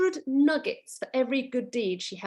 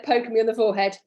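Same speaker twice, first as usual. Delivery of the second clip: ordinary delivery, nothing marked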